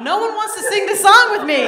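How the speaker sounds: loud, high-pitched playful protest; mock indignant complaint. Comedian whose audience won't sing the song with her, loud mock complaint. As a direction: cry out in mock outrage at the crowd.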